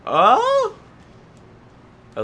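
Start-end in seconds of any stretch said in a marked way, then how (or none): 0.1-0.8 sudden high yelp; surprised yelp. Real, not acted. Player spotting a clue, sudden high yelp of surprise. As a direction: jump at the discovery.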